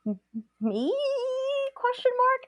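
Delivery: hesitant, then a very high squeaky rise; mock-timid questioning. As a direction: play up a tiny, unsure question. Timid answer to grandmother's challenge.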